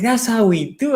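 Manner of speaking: high, mocking imitation of a gloating fan; taunting lady. Fan mocking him after her team scores, in a high taunting voice. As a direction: sing it out like a smug rival fan. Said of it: "she's like".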